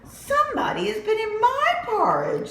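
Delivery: high indignant mama bear. Acted. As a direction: shriek it like an offended mother. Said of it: "said Mama Bear".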